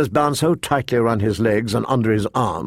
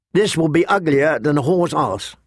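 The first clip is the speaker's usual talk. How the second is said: ordinary delivery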